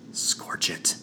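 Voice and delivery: whispered groan of frustration. Acted. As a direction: exhale it in dismay. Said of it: "Tosca groaned".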